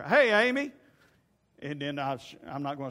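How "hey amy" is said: calling out. Retold or imitated. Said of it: "I don't stand over here".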